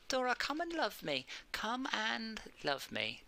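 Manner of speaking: soft, slow coaxing to a cat. Real, not acted. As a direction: coax the cat gently.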